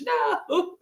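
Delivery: normal talking voice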